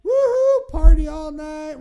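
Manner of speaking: loud high woohoo, drawn out; party whoop. Retold or imitated. Mocking his earlier party mood, loud whoop. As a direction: whoop like a night out. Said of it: "it went from".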